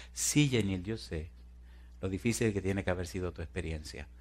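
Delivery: low, very soft comforting voice; acted sympathy. Fireman comforts the trapped woman, softly sympathetic. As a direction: console gently, almost under the voice.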